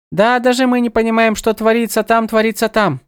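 high, lilting birdlike goddess; sing-song, echoing her own words; bird goddess voice. A narrator's reading.

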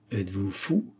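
understated, offhand jeer; mocking. A narrator's reading. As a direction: brush the challenger off with light mockery. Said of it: "railla le marquis". (légère raillerie)

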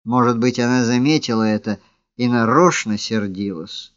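ordinary delivery, nothing marked

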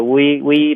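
V turned into "wee", lips rounded; wrong W sound. Shown as a demonstration. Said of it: "它不是一个". (把 v 错发成 w)

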